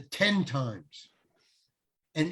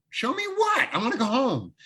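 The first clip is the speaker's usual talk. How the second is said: high-pitched mock whine of disbelief; retold exasperation; 8 semitones higher than usual. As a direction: protest with the pitch shooting right up. Retold exasperated reply to director.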